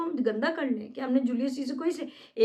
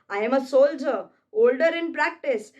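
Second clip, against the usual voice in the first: proud soldier's claim, said slowly and louder; slow, louder stage boast; 5 dB louder, much slower than usual. Acted. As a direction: declaim it like a proud old soldier. Recites Cassius boast.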